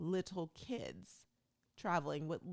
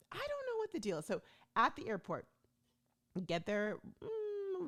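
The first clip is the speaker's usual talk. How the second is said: usual speaking voice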